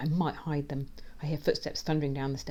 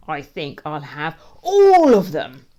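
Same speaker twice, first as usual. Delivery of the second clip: slow, building to a huge loud "all"; greedy relish; 16 dB louder, slower than usual. A narrator's reading. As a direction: draw it out, then burst with greed. Greedy villain claims every biscuit.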